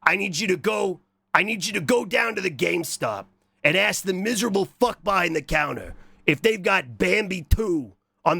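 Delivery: mock-threatening boss giving orders; bossy boss. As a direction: play the boss laying down the law. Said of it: "instead of bossing around".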